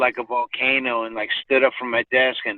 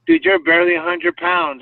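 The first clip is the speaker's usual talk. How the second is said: scoffing. Retold or imitated. Scoffing at small friend.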